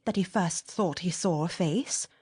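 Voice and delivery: usual speaking voice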